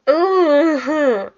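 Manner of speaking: high, loud wailed "ugh"; loud tearful groan. Real, not acted. Overwhelmed by the memory, groaning loudly. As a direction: let out a long emotional groan. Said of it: "I'm gonna cry".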